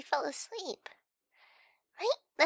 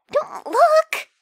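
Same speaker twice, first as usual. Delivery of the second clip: panicked shout; 12 semitones higher, 15 dB louder than usual. Acted. Panics, tells partner not to look.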